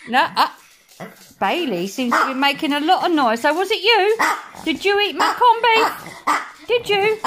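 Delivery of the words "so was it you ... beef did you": high, accusing questions to the pets; mock interrogation. Real, not acted. Owner grilling her dogs about stolen corned beef, mock accusing. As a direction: grill the dog like a suspect.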